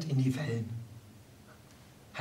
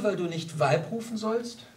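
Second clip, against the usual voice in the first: ordinary delivery, nothing marked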